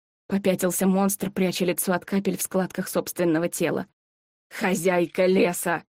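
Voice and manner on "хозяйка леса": growling monster voice. Acted. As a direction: recoil and rasp the name. Monster recoils in recognition. (испуганный рык чудовища)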